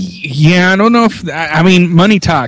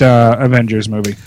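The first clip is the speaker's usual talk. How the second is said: ordinary talk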